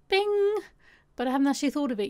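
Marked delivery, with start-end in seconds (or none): 0.1-0.6 high, loud bing; playful sound effect. Pretend. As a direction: pop the word out like a chime. I'll put it here.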